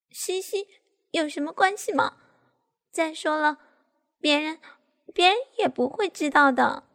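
high, wheedling insistence; feigned urgency. A narrator's reading. Daughter pretends to worry while persuading, feigned urgency. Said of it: "假装着急说".

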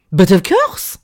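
put-on casual French-accented shrug; nonchalant French. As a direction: say it as if nothing was wrong, lightly French. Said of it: "Like there wasn't a problem".

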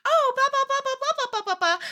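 high mock girly chatter. Girls pattering in, mock chatter.

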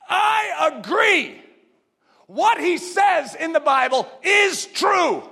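high, slow, ringing I agree; fervent declaration. Real, not acted. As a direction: proclaim it like a preacher.

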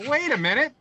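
ordinary talk

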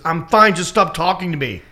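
high, loud snapping; retold annoyance. Injured girl to apologizing comic, mad and fed up. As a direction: imitate an angry brush-off. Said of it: "she got mad at me".

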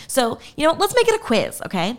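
normal talking voice

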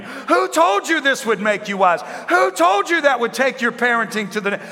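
high, loud, insistent questioning; God questioning. Acted. God confronting Adam, loud repeated questions. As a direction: press each question harder as God.

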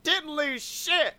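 high, slow, stubborn politician impression; mock sore loser. Candidate told he clearly lost, mock stubborn denial. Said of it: "the not decent person is still like".

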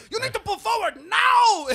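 very high, full-volume screech of an irate attendant; mimicked hysterical shouted command; imitated screeching. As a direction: screech the order like a drill sergeant. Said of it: "starts screeching at us".

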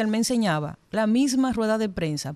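ordinary talk

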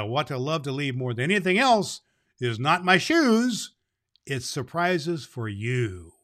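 ordinary delivery

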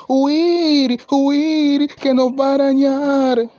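high, loud, drawn-out cry of alarm; frightened goats. A narrator's reading. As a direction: sound the alarm high and stretched. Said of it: "se asustaron y se fueron a huir".

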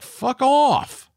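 very high, long comic holler; exaggerated high-pitched send-off; mock yelled dismissal. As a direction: yell the chef away, hammed up. Acted retort to showboating chef.

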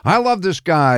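proud, high-pitched praise; affectionate boast. Retold or imitated. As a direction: gush about your favorite guy to the room. Quoting Milty praising him at parties.

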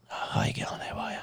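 whispered, nervous little greeting; embarrassed whisper. Retold or imitated. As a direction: whisper it so nobody hears. Greeting the pharmacist awkwardly.

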